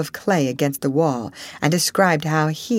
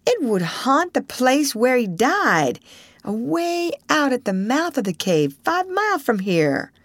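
high-pitched arguing; 8 semitones higher than usual. Acted. Tom argues, voice high.

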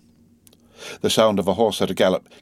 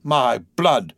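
higher-pitched vexed coachman; vexed exclamation; 6 semitones higher than usual. A narrator's reading. Coachman fuming over the late hour, vexed. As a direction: exclaim it in vexation. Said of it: "ejaculated the vexed coachman".

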